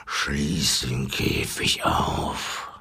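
very low, slow rumbled command; grollender Löwe. A narrator's reading. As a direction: growl the order deep and drawn out. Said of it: "grollte der Löwe". (tief und langsam grollend befohlen)

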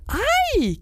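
very high drawn-out squeal; thin, high-pitched shriek; screech. A narrator's reading. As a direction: screech it high, storybook style. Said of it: "kreischte Flitter".

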